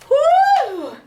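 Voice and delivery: high, loud whoop with a laugh; whooping cheer. Real, not acted. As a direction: let out a joyful whoop. Cheer after finishing set.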